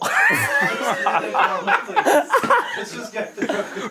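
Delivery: high shrieking laugh; cracking up mid-attempt; loud burst of laughter. Real, not acted. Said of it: "No, Mark, I could do this".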